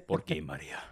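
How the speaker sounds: deep telenovela leading man; low telenovela lover. Acted. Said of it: "I always do it lower if I wanna be, like, telenovela".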